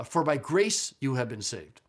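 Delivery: "grace" drawn out and emphasized; slow phrase leaning on "grace", then easing off; stress on grace. Shown as a demonstration. Teacher showing Greek students English emphasis, stress on "grace". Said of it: "You hear the emphasis?".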